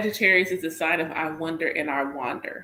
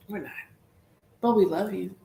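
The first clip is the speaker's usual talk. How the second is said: ordinary delivery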